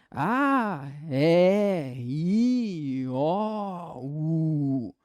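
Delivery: each of "A, E, I, O, U" held long in a rising-falling swoop; open sustained vowels. Shown as a demonstration. Teacher showing unobstructed airflow, long swooping open vowels. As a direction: let each vowel ring out freely, stretched. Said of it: "é um negócio meio de maluco".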